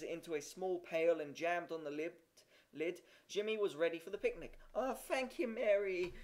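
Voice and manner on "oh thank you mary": put-on high, delighted child's thanks; gleeful, pitched-up gratitude; joyful cry. A narrator's reading. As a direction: cry out with a small boy's joy. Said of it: "he cried joyfully".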